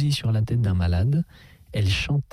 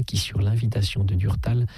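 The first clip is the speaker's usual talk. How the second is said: plain reading voice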